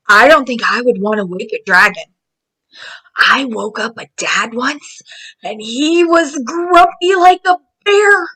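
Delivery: ordinary delivery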